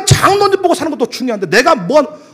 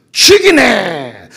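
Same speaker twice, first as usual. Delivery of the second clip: Gyeongsang dialect; 5 dB louder, much slower than usual. Retold or imitated. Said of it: "경상도 말로는".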